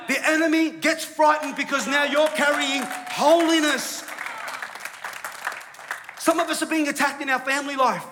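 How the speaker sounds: high, loud, emphatic preaching; impassioned sermon climax; full-force preaching. Real, not acted. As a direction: drive it home to the congregation at full voice.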